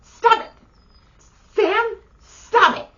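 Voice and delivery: high, loud, slowed scolding cry; retold yell. Scolding her dad over the cookies, high loud yell. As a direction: yell at him to stop, drawing out Sam. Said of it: "and I'm all".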